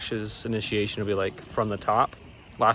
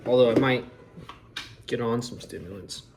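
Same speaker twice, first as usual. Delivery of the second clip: ordinary talk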